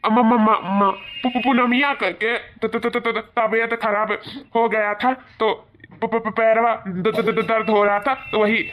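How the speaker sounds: stuttering on every first sound, caught red-handed; slow, tripping repeats of p, t and d; scared son stammering to his mother; panicked stammer. Acted.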